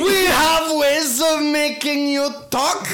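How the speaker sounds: cool foreign spy menace; slow, high, loud mock German villain; German accent. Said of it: "Although that was German".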